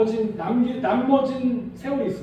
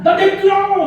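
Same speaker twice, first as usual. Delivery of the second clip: high loud petulant yell; stubborn yell; 10 semitones higher, 10 dB louder than usual. Retold or imitated. Preacher mocking a sulking believer, high petulant yell. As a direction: shout it like a sulking child. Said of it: "막 이러고 있어요".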